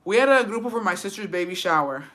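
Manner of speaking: normal talking voice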